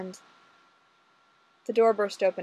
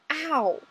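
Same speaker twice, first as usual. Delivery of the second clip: higher yelp of pain; 7 semitones higher than usual. Acted. Ray yelping as Olga pulls his hair, pained ow. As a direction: yelp as your hair is pulled. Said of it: "Ray moaned".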